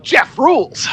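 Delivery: high, loud, gleeful cheer; excited cheer. Acted. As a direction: cheer yourself on. Jeff talks in third person.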